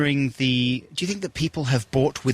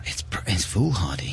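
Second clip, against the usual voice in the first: hushed; 5 semitones lower than usual. Acted. Mock hushed aside on radio.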